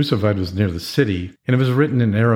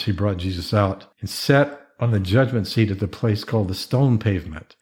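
usual speaking voice

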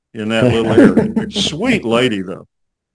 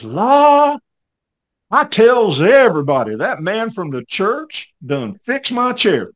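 Southern drawl; 7 semitones higher, 5 dB louder, slower than usual. Retold or imitated. Grandmother proudly telling about her fixed chair, in a high imitated Southern drawl.

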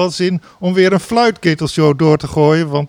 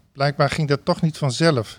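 ordinary talk, nothing marked

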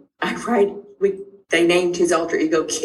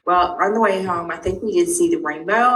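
ordinary delivery

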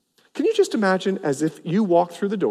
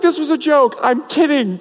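laughing backpedal; 8 semitones higher, 3 dB louder than usual. Retold or imitated. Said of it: "I'm like".

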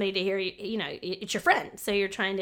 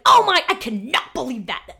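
loud, slow show of disbelief; be the friend scandalised on my behalf; hammed-up shock, higher and drawn out; mock outrage; 4 semitones higher, 8 dB louder than usual. Acts out a supportive friend's outrage.

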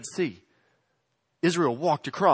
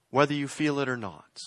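ordinary delivery

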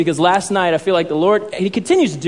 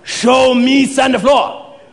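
stern sensei impression, slow and raised; Mister Miyagi; 6 semitones higher, 3 dB louder, slower than usual. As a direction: command the pupil in drawn-out words. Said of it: "Mister Miyagi's like".

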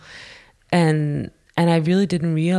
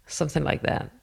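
ordinary delivery, nothing marked

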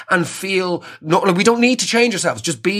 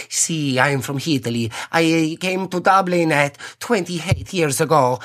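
cartoonish Italian accent. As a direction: lay on a fake Italian accent, proud of it. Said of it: "cartoonish Italian accents".

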